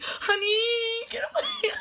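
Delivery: very high, squealed fangirl panic; freaking out. Retold or imitated. Fan retelling the star coming up to her, freaking out. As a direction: shriek it up high as if overwhelmed. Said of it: "I was freaking out".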